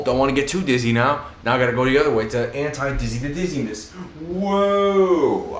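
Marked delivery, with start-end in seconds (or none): 3.9-5.6 excited whoa. Real, not acted. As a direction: cry out with delight while spinning. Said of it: "I'm spinning in a chair".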